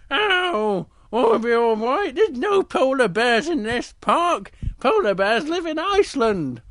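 high, loud protesting cub; Little Bear voice. Acted. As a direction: brush off the warning in a high voice. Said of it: "says to Little Bear".

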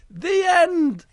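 high, loud, drawn-out finish; grand story ending. A narrator's reading. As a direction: proclaim it as a big finale. Storyteller closes the tale.

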